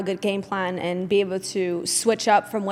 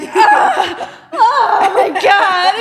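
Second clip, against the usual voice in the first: high, loud mock scream of agony, half laughing; imitating someone screaming in pain, laughing through it; 11 semitones higher, 8 dB louder, much slower than usual. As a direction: wail it like a man hit by period cramps. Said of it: "And he's screaming".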